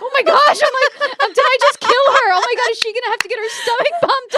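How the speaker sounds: high, loud frantic panic with a nervous laughing sob; retold panic. Mom panicking that her prank toothpaste hurt his wife, frantic high squeal.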